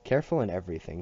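ordinary delivery, nothing marked

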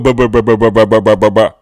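low, droning "buh-buh-buh"; imitated baritone mumbling. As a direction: imitate a deep voice babbling on in the background. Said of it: "the mumbling in your ear".

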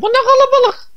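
high, silly cartoon squeal; squeaky character voice. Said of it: "Vardı ya".

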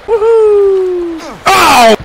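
high, loud whoop then a shocked cry; excited whoop. Real, not acted. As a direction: yell as you drop over the edge. Falling off the waterfall.

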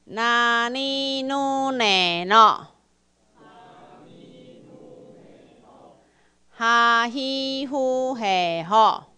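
each syllable drawn out, loud and slow; slow loud drill. Shown as a demonstration. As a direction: lead the class in a slow chant. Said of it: "啊，来，". (大声慢速带读)

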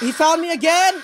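loud, high cry of dismay; panicked, pitch shooting up; alarmed. Real, not acted. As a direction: yell it as the chaser reappears. Monster finds the player again.

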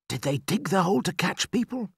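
put-on high-pitched child's voice; questioning boy. A narrator's reading. Son asking his father about the trap, boy's voice. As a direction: ask like a young boy, wondering.

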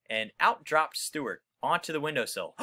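ordinary delivery

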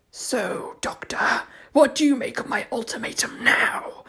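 a sour, wet whisper of a monster; sour wet creature voice. Acted. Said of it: "with a silvery smile".

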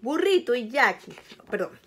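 plain reading voice

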